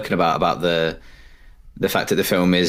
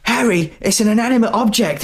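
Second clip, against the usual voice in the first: much higher, calm wife voice; 13 semitones higher than usual. Retold or imitated. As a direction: pitch way up, patient wife. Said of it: "And his wife says".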